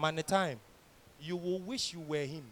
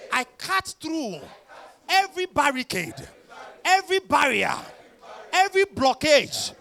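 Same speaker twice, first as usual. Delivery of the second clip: high, shouted, building preacher fervor; righteous paladin declaration; 6 semitones higher, 12 dB louder, slower than usual. Acted. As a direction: roar each claim of faith louder.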